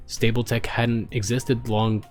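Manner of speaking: ordinary delivery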